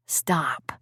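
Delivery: soft whispered stop. Acted. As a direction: hush her gently in a whisper. He cuts her off gently.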